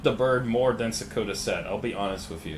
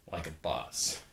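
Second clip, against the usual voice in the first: low, soft throwaway catchphrase; said under the voice, low; quiet aside; 7 semitones lower, 9 dB quieter than usual. Real, not acted. Catchphrase said low, then slowed.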